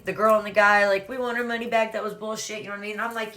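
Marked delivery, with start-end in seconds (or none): none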